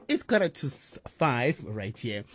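ordinary talk, nothing marked

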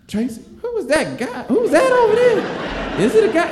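put-on high, nosy teen girl whispers turning to squeals; gossiping girls. Neighborhood girls ask Tracy about the masked boy, high nosy voice. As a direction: gossip about the stranger in the corner. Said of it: "They like".

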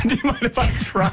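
laughing mimicry. Mimicking bad breath guy laughing.

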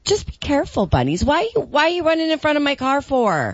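high sweet princess voice. Acted. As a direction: talk sweetly to the bunnies like a princess. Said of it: "my little princess voice".

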